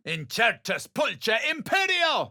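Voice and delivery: high shouted incantation; shouted spell. Acted. Adventurer casting a charm spell, high shout.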